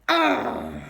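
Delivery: low, rough groan of dismay; Balthazar Brat. Acted. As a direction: groan like a beaten villain. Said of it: "Balthazar went".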